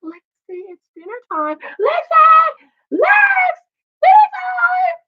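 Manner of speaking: high, loud call up the stairs; yelling up the stairs. Retold or imitated. Mom calling daughter down for dinner, high loud yell. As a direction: holler her name from the bottom of the stairs, pitch way up. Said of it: "I'm yelling up the stairs to Lexi".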